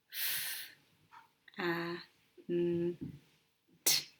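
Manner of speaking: "F, A, N, T" as four isolated phonics sounds; "F, A, N, T" segmented slowly, short "a" as in ant; sounded out. Shown as a demonstration. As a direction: say "F, A, N, T" one sound at a time with pauses, not letter names. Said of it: "What sounds do you hear in fant".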